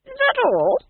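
very high, squeaky question; disbelieving duke, pitch leaping up; astonished high query; incredulous. A narrator's reading. Incredulous Duke after long silence.

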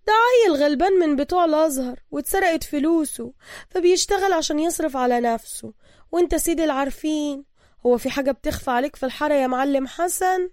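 sly market woman; boss woman's voice. Acted. Boss woman defending Bruce to the thug, sly.

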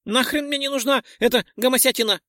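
high scornful pixie snort. Acted. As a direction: sneer it in a tiny voice. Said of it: "фыркнула Чопля". (писклявое презрительное фырканье)

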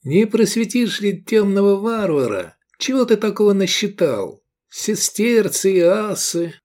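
high-pitched humble plea. A narrator's reading. Self-styled barbarian asks a learned friend about coins, humbly. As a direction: ask high, as a lowly pupil begging enlightenment. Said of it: "смиренно сказал Эдик".

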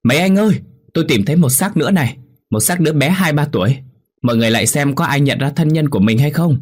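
high, loud shout across the water; calling out loud. Acted. Husband calling other boats after finding a body, loud urgent shout. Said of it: "sau đó mới kêu lên".

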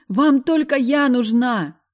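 desperate shout. Acted.

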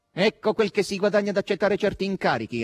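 loud, high, affronted complaint; offended exclamation. A narrator's reading. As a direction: exclaim it as if insulted. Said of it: "esclamò il rosso, offeso".

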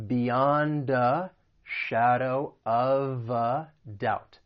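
"beyon-duh shadow o-vuh doubt", drawn out; slow model, each A a linked uh; slow schwa linking. Shown as a demonstration. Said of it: "link some consonants".

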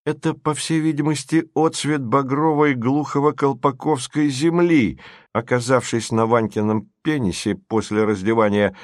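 plain reading voice

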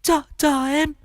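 very high, soft, ghostly coo; eerie possessed voice. Acted. Possessed Hà cooing to an unseen lover, high and ghostly. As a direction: lure someone away in a haunted sing-song.

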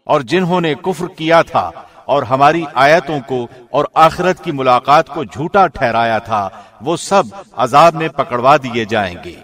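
usual speaking voice

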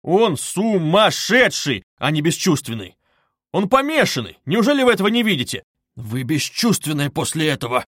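high, forceful, voiced urgency; impassioned protest; heated. A narrator's reading. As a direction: insist fervently, pressing the point. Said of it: "горячо прошептал Разумихин".

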